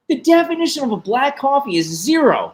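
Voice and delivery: high, insistent retold rant at the barista; hammering each "no" in mock outrage; exasperated rant. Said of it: "I was like".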